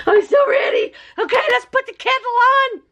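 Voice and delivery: high, loud, giddy cries with laughter; can't contain the excitement, shout it out; excited shouting. Real, not acted. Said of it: "I'm sorry I'm shouting".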